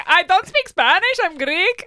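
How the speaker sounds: high-pitched imitated protest; put-on high voice correcting someone; protesting cleaning lady. Acting out the lady's protest.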